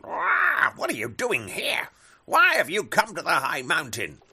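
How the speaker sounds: loud, high startled bird cry; shouting birds. Acted. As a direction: cry out at the intruder.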